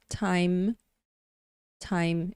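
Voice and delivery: "Time" said like Portuguese "taim"; pronunciation demo. Shown as a demonstration. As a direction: model "Time" as /tajm/, said twice. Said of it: "Fica exatamente como a gente fala".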